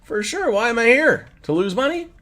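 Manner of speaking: high, loud, laughing at such an obvious question; mock incredulous. Real, not acted. Answering a viewer about ten dollars, high scoffing laugh.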